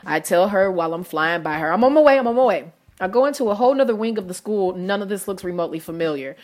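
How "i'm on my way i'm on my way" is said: fast, higher, louder rush; hurried. Retold or imitated. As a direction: blurt it while flying by. Rushing past supervisor.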